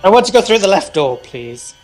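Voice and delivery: normal talking voice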